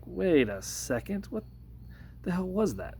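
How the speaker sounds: a stunned wait-what, slowed down and climbing high; retold disbelief. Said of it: "and I was like".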